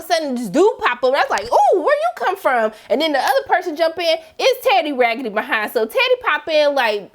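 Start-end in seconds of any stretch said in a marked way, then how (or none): 1.4-2.7 higher, laughing ooh; delighted shock at a newcomer; amused surprise. Retold or imitated. Surprised at a new character.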